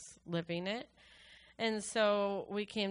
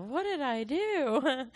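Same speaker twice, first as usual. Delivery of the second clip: slow, choked, higher cry; tearful dismay; 5 semitones higher, 4 dB louder, slower than usual. Retold or imitated. Recalling emotions after surgery, slow and tearful. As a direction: break on the question. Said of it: "I thought to myself".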